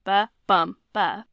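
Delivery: "ba, bum, ba" with only "bum" stressed; weak, strong, weak on "ba, bum, ba"; stress in the middle. Shown as a demonstration. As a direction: lean on BUM between two light "ba" beats. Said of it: "unstressed, stressed, unstressed".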